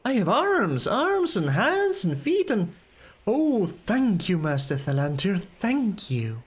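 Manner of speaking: slow, high-pitched swooping thanks; overjoyed apprentice voice; relieved, grateful Melicamp. Acted. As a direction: marvel at being restored, drawing the words out. Voices Melicamp in game dialogue.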